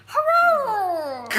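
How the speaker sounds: loud high cheer. Retold or imitated. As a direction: shout it out happily. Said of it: "started and ended that recording by going".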